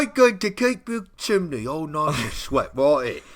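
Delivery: high, hammed-up English chimney-sweep goblin; English accent. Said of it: "no more English accents".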